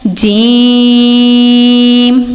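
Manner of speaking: جیم with the vowel held very long, from mid-tongue; drawn-out letter demo. Shown as a demonstration. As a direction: stretch جیم far out. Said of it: "اس کی ادائیگی آپ نے ایسے ہی کرنی ہے". (جیم لمبا کھینچ کر)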